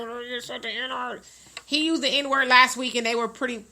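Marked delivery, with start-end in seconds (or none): 0.0-1.2 hushed, hurried aside under the breath; retold whisper. Co-host retelling his quiet warning to his partner, whispered. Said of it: "I was whispering, like".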